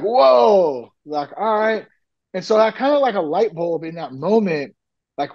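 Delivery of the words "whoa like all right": stunned whoa. Retold or imitated. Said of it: "And I was like".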